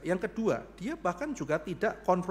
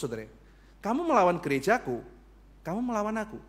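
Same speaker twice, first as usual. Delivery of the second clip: usual speaking voice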